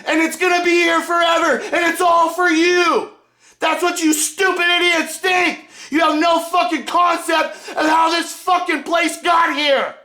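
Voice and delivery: high, raw screaming rage; furious shout. Real, not acted. Cursing the Democrats as slaves, in a furious high shout. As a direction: scream it at them, voice cracking.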